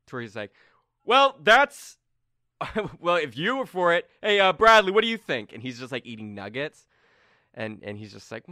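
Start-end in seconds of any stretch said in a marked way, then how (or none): 1.0-5.5 high, loud nervous cheer; Turi voiced. Game master as Turi dodging Colm by turning to Bradley. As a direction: laugh it off and change the subject.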